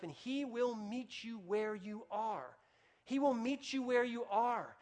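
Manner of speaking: slow, earnest preacher's promise; preaching. Acted. Pastor assuring the congregation, slow and earnest. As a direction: lean in and promise it slowly.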